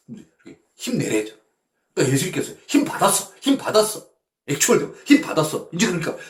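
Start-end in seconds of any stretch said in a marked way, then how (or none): 2.7-5.7 energized. Pretend. Jesus voiced feeling strengthened.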